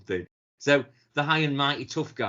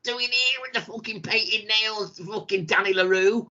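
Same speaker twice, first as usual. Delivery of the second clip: high, put-on sneering [name]; imitating [name], pitched up and scornful; mock [name] sneer; 9 semitones higher than usual. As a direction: do a scornful rock star mocking a guest. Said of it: "[name]'s there going".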